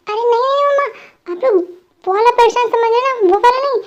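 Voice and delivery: high, flustered denial; embarrassed husband protesting to his mother. Acted. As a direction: hurry to correct mom, squirming.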